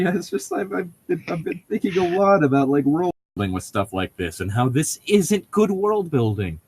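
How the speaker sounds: usual speaking voice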